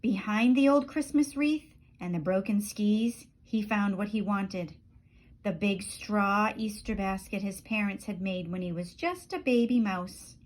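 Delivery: ordinary delivery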